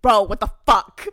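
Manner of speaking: loud exasperated friend impression; imitating a friend's outburst. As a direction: yell it like the startled buddy. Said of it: "used to do that shit and be like".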